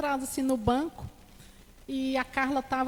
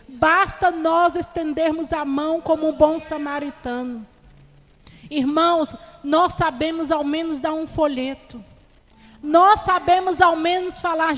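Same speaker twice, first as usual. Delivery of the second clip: louder, fervent sermon delivery; preaching; 3 semitones higher, 7 dB louder than usual. Acted. Preacher urging the brethren to keep evangelizing, loud fervent preaching. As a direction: preach it to the church with fire.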